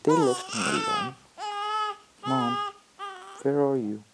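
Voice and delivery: high tearful cry for mom; crying child. Acted. A lost child calls crying for her mother. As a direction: sob it out like a lost little child.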